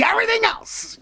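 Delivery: very high, silly voice; mock emphatic squeal. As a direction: repeat it in a comic falsetto.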